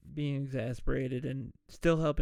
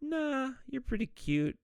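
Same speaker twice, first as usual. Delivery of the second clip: flirty Marian; 10 semitones higher than usual. Retold or imitated. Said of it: "She's like".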